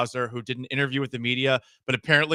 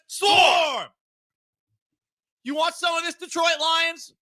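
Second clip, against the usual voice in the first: hyped shout; 10 semitones higher, 4 dB louder than usual. Acted. Pumped up Texans taunt.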